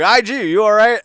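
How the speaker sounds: high, loud worried call. Retold or imitated. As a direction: shout over to him. Calling to injured robot.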